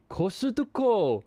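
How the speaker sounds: "Costco" drawn out as ko-su-to-ko; Japanese pronunciation. Shown as a demonstration. Host showing how they say it, "Costco" as ko-su-to-ko. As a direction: say "Costco" slowly with added Japanese vowels. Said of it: "they say Costco".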